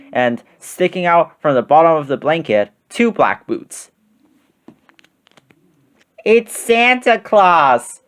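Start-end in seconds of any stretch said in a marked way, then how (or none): 6.2-8.0 high, drawn-out little bear cry; excited cry. A narrator's reading. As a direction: cry it out as an amazed cub. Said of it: "cried Baby Bear".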